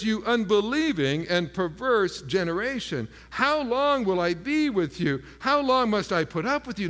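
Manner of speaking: preacher voicing a stern, upset reproach; harsh rebuke. Jesus rebuking an unbelieving generation, harsh and exasperated. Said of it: "one of the harshest statements".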